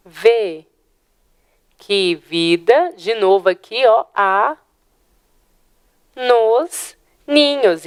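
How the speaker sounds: verse stretched out with long pauses; drawn-out reading that marks each syllable; slow scansion. Shown as a demonstration. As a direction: scan it slowly, merging the vowels. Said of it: "eu faço a junção desse som".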